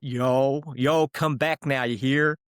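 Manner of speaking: slow Southern US accent; imitation of North Carolina American speech. Shown as a demonstration. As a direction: put on an American accent, drawing the words out. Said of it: "a good phrase you like to say within an American accent".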